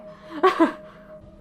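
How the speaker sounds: short laugh. Real, not acted. Laughing at razor blade scene.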